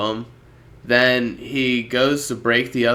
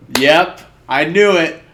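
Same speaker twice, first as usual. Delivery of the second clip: gloating; 4 semitones higher, 6 dB louder than usual. Retold or imitated. Guy gloating over his guess.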